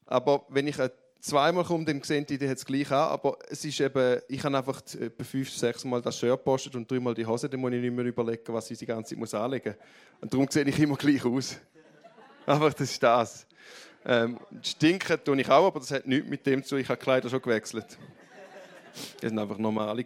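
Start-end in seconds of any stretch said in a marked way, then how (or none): none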